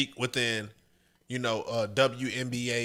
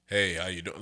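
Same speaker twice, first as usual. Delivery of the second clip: lower, deep smooth greeting; 7 semitones lower than usual. Shown as a demonstration. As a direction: drop into a low octave. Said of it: "they like a little deep".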